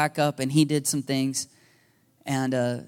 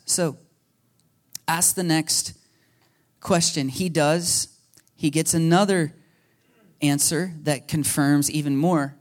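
ordinary talk

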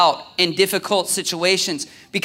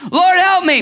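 much higher, louder cry for help; desperate cry; 12 semitones higher, 7 dB louder than usual. Retold or imitated. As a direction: yell it like you're going under. Peter sinking, crying out.

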